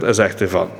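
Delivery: normal talking voice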